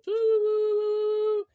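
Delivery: high loud sustained doo like a horn; mock shofar toot; shofar blast imitation. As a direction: blow it out like a shofar. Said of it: "They go".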